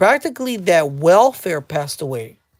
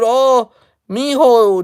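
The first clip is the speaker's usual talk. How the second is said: louder, drawn-out coo of a mock mother; doting mother; 5 semitones higher, 6 dB louder, slower than usual. Mommy offering her son food, slow doting mock-mother voice.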